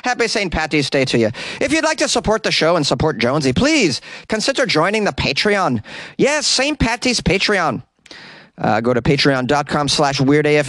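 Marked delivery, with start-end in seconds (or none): 0.1-7.8 high, hammed-up Irish lilt; butchered Irish accent. Host asking listeners to join the Patreon, butchered Irish accent. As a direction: make the pitch in a bad Irish brogue. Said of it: "I'm the king of butchering accents".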